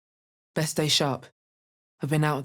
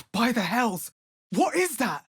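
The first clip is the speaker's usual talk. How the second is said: startled gasp; 6 semitones higher than usual. Acted. Sudden sight of a monster.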